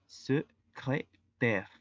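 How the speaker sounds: secrétaire drawn out very slowly in French; slow French model. Shown as a demonstration. Teacher slowly models secrétaire for Spanish-speaking students. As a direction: stretch out secrétaire syllable by syllable. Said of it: "la pronunciación es".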